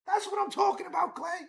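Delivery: high, loud hype for the pitcher; real excitement over a strikeout; excited cheer. Real, not acted. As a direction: cheer him on like a fan. Celebrating a strikeout, shouting.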